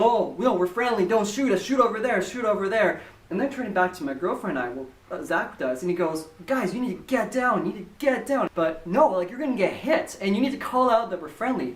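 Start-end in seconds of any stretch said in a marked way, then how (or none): 0.0-3.0 playful mock alarm, raised pitch; mock pleading. Riders plead with the attacking pirate ship, mock pleading. 6.5-11.7 higher-pitched play-acted urgency; mock urgent warning. Wrestler warns fellow riders on the pirate ride, mock urgency.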